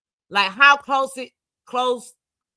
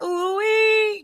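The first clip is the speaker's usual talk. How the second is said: high-pitched mock ooh-wee; playful high ooh-wee; 11 semitones higher than usual. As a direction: squeal ooh-wee up high, imitating her. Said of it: "she said she came home drunk, smelling like".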